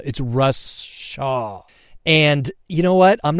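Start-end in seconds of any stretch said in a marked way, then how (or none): 0.0-1.6 slow, every syllable of "Russ Shaw" laid out; slow and clear. Shown as a demonstration. Host correcting his name for listeners, very slow. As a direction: slowly stress "Russ" so nobody mishears. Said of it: "I said that really fast".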